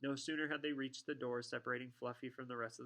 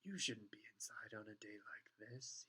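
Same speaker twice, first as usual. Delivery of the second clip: soft, whispery smoothness; smooth Snape; 7 dB quieter than usual. A narrator's reading. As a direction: slide it out in a hushed, sly voice. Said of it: "he said smoothly".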